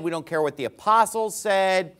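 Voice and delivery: ordinary talk, nothing marked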